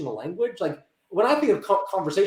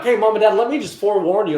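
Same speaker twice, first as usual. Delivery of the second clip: louder, upfront heads-up; heads-up warning; 3 semitones higher, 6 dB louder than usual. Retold or imitated. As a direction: call for their attention before breaking news. Acting out warning call to parents.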